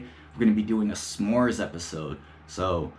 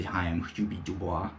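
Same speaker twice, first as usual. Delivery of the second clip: soft, lower mock baby voice on Hubie Dubois; Adam Sandler baby voice; 4 semitones lower, 6 dB quieter than usual. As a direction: mumble Hubie Dubois like a shy man-child. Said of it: "Adam Sandler's baby voice".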